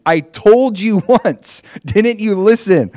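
high, loud, drawn-out exasperation; exclaiming. A narrator's reading. Healed man to his questioners, exclaiming in exasperation. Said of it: "the man exclaimed".

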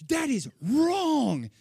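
high, loud, slow, drawn-out; loud slow shout. Retold or imitated. Professor yelling at the class, loud and drawn out. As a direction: stretch it out in a piercing yell. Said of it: "she's like".

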